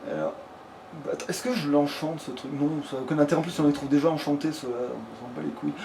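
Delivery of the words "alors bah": usual speaking voice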